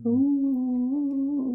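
high ghostly ooh; ghost wail. Pretend. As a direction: wail like a haunting spirit. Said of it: "can you do a ghost?".

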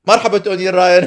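cheery shout-out; loud playful greeting. Pretend. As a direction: call out loudly. Greeting podcast hosts. (تحية عالية مرحة)

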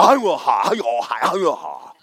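lightly imitated ecstatic babble; a hint of mock holy-roller chant; mock tongues. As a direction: mimic the worshippers' babble with a laugh. Mocking tongues-speaking churchgoers.

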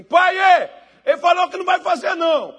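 high, loud tattletale yell; acted kid's shouted complaint. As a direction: holler to dad across the house. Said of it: "gritei assim".

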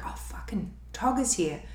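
under the breath, nearly whispered; retold hushed dismay. Spotting the cop's car from the guest room, hushed dismay. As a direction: imitate a private, whispered curse.

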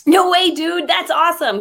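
excited gushing. Acted. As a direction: blurt it out like an excited fan. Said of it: "'cause I get so excited".

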